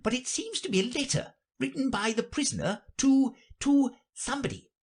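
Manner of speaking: very high, hesitant rabbit voice; high voice, faltering near the end. Acted. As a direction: report as a high-pitched courtier rabbit.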